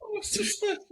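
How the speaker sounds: put-on very high, overdone British accent; hammed-up British lady accent; bad British accent. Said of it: "worst British accents ever".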